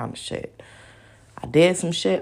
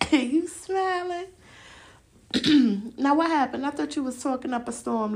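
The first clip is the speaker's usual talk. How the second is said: put-on high, sing-song cooing to a baby; baby talk; 10 semitones higher, 3 dB quieter than usual. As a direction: coo up high at the little one.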